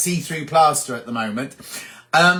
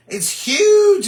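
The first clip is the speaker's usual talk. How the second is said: high, louder squeal of amazement; astonished exclamation; 6 dB louder than usual. Real, not acted. Showing viewers the ganglion on the body, astonished exclamation. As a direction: exclaim at how enormous it has grown.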